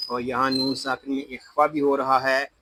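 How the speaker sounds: ordinary talk, nothing marked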